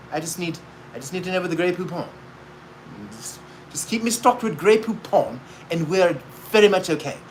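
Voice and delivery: mock a fussy rich man in the back seat; pampered passenger. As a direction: limo passenger asking after his Grey Poupon, mildly prim. Mocking a pampered limo passenger.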